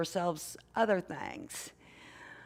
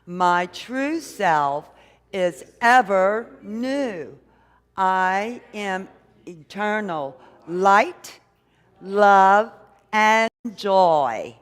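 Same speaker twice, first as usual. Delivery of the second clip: affirmation; 11 dB louder, much slower than usual. Shown as a demonstration. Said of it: "let's really align with it and say it like we mean it".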